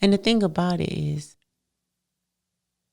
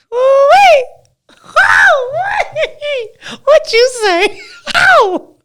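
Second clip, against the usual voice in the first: much higher, louder, laughing shouts of delight; delighted squeal; 12 dB louder, slower than usual. Pretend. A woman hearing she's beautiful, laughing delighted shouts. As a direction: squeal like you just got the best compliment. Acted thrill at a compliment.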